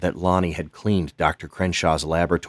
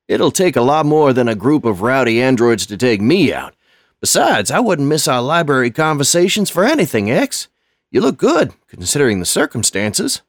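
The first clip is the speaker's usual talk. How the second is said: put-on much higher and louder, boastful old man; cheerful tough old custodian; 7 semitones higher, 7 dB louder than usual. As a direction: brush off danger with a grin.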